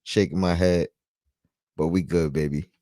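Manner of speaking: normal talking voice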